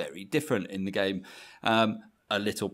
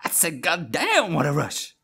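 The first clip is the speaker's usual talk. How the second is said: John Travolta; 3 semitones higher, 3 dB louder than usual. Acted. Repeating the impression on the co-host's request, film-star holler. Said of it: "How does it go again".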